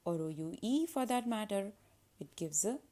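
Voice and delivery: ordinary talk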